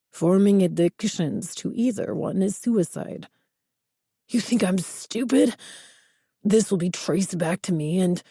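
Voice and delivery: put-on a threatening boss in thick Spanish accent. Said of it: "A heavy Spanish accent".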